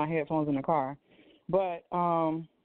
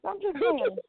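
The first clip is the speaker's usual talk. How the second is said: high, playful teasing; 10 semitones higher, slower than usual. Real, not acted. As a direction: tease with a high lilt. Repeats the tease.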